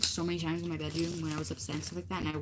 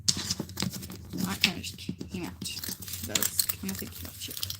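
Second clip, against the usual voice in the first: slow breathy whisper; slower than usual. Real, not acted. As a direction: whisper it to yourself. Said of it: "whispered aside".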